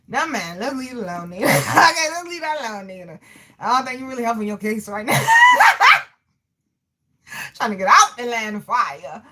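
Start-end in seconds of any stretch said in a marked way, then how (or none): 5.2-6.0 very high, loud burst of laughter; loud cackle. Real, not acted. Streamer teasing a viewer in chat, high loud cackle. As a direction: cackle loudly at a squeal.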